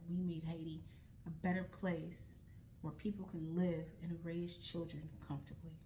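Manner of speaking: usual speaking voice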